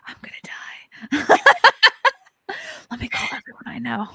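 a hammed-up terrified whisper; mock panicked whisper. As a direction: whisper it like you're doomed. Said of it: "I was like".

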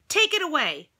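loud firm order; storybook command; mild command. Acted. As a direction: order the couch gone. Family wants couch gone.

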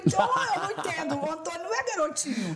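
high, animated retort; playful outraged comeback; mock-indignant protest. Jokingly protesting a press rumour amid laughter.

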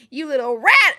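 loud mock yell; playful reenactment of a scolding yell; imitating her yell. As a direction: yell it like an outraged accusation, retold. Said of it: "what this reminds me of with her yelling".

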